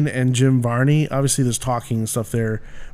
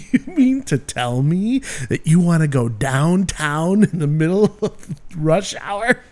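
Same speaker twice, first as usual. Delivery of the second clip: Ernest voice; 6 semitones higher than usual. Retold or imitated. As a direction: stretch it out like goofy Ernest. Ernest quote bit.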